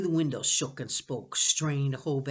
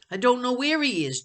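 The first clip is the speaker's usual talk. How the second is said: sniffling; 10 semitones higher, 8 dB louder than usual. Acted. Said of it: "Jonathan sniffed".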